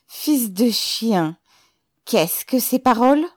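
high, loud incredulity; loud mocking astonishment; astonished voice. A narrator's reading. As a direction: scoff loudly in amazement. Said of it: "d'une voix étonnée".

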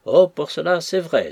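loud, high-pitched exclamation; exclaiming. A narrator's reading. Osborne to the ladies amid his teasing, exclaiming loudly. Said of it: "s'écria Osborne, redoublant ses éclats de rire".

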